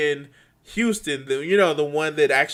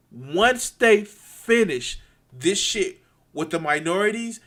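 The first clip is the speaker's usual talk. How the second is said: normal talking voice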